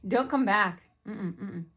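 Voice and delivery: ordinary talk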